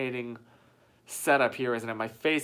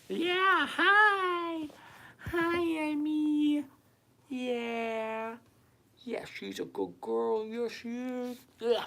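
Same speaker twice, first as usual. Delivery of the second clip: talking to Emmy voice; 9 semitones higher, much slower than usual. Real, not acted. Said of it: "this is my talking to Emmy voice".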